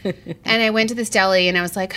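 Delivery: ordinary talk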